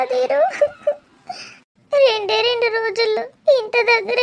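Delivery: crying, breaking voice, pleading with an absent lover; tearful lament. Acted. Abandoned woman begs her lover Dheeru not to leave, crying. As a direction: let the sobs break through the words.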